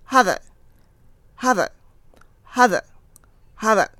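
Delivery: 'Have a' joined as 'hava', crisp separate repetitions; linked words. Shown as a demonstration. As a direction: drill 'Have a' as one linked word. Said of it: "ここだけ練習していきましょう". (「Have a」を「ハヴァ」と一語のように繰り返す)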